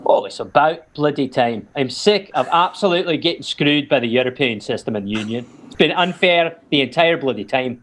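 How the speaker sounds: fed-up Scottish voter impression; Scottish accent. Asked what Scottish Brexit voters say, indignant Scottish accent. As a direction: rant like an aggrieved Scot. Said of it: "you were talking to some Scottish people".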